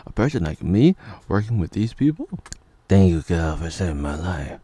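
normal talking voice

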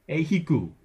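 "Ehiku" lifted high as a Hawaiian word; attempted Hawaiian pronunciation. Shown as a demonstration. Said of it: "not going to attempt to pronounce these".

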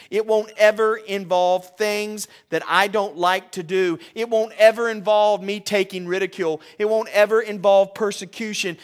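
hammed-up pleading believer, high and loud; mock whiny prayer. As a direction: beg God like a bargaining child. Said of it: "You wanna know what we say".

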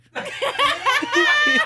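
real shrieking laugh; high shrieking laugh. Real, not acted. Laughing at own story.

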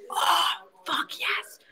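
slow, breath-only exclamation; delighted whisper. Real, not acted. Reacting to a longed-for present, delighted whisper. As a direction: whisper it slowly, thrilled.

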